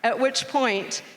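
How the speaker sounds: ordinary delivery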